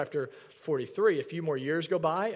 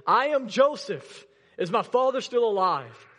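Joseph bursting out to his brothers; emotional reveal; 6 semitones higher, 6 dB louder than usual. Acted. Joseph revealing himself to his brothers, loud and raw.

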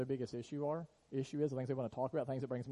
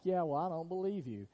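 sceptical objection; 5 semitones higher, 4 dB louder than usual. Pretend. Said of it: "And if you're like".

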